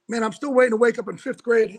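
normal talking voice

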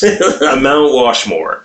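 slow "Mount Washmore", "Washmore" with a Baltimore "wash"; slow Baltimore joke. Shown as a demonstration. Naming a Baltimore accent list, slowly and deliberately. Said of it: "Mount Rushmore".

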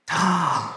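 soft gasp of elation; a breathy, joyful gasp; delighted gasp. Acted. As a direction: gasp in happy relief. Mouse's delight on hearing her thoughts.

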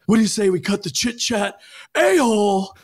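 high, loud movie-hero outburst; mock angry. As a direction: play it hammed-up mad, punching the last word. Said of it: "where he is getting mad at".

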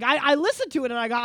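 normal talking voice

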